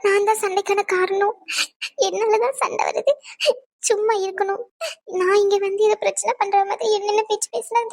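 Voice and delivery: hurt words broken by sobs; tearful outpouring. Acted. Wife telling her friend how she was blamed for fights, crying. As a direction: pour out the hurt while crying.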